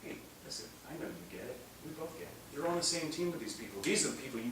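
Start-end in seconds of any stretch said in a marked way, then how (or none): 0.0-2.5 hushed, confiding aside to the person you stand beside; quiet aside. Acted. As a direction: lean in and speak softly, as a friend.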